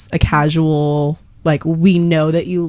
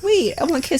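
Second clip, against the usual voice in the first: high, softer needy plea; pleading for kisses; 9 semitones higher, 6 dB quieter than usual. Retold or imitated. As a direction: whimper for kisses. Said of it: "I'm like".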